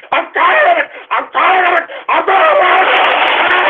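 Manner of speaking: exasperated yelling. Real, not acted. Host at breaking point over prank calls, exasperated yelling. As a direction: yell it harder each time until it stretches out.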